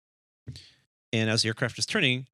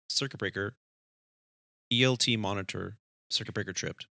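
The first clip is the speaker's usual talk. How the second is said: ordinary delivery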